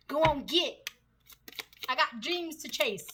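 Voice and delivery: a laughing country accent on "Go on, get". Shown as a demonstration. Reader shoos off the ex in a country accent. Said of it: "like that country accent".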